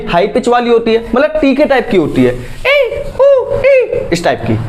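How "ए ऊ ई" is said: much higher, squeaky vowels; high girl's voice. Shown as a demonstration. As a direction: show how high a girl's voice sounds. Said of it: "girls की जो sound है". (लड़कियों जैसी ऊँची आवाज़)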